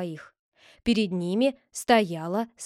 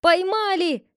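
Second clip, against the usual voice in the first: much higher, louder triumphant cry; excited shout; 9 semitones higher, 5 dB louder, slower than usual. Acted. The constables burst in shouting, excited. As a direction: shout the news. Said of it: "вскрикнули".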